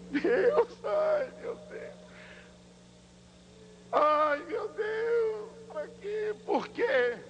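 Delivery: high, slow wailing cry; crying out to God. Retold or imitated.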